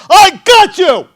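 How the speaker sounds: yelled high and loud in triumph; triumphant shout. Retold or imitated. SOC guy bursting into the room, shouting triumphantly. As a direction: bellow it like you caught a thief. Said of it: "He slams open the fucking door and he goes".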